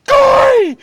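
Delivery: loud high shout of a name; sudden startling yell. Retold or imitated. Friend sneaks up behind Corey to scare him, sudden high shout. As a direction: yell it to make someone jump.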